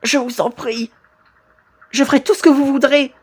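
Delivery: a touch of acted desperation; pleading victim.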